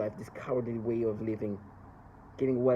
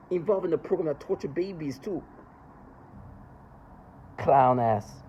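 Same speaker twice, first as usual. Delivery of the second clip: angry; 4 semitones higher, 4 dB louder than usual. Real, not acted. Insulting the perpetrators.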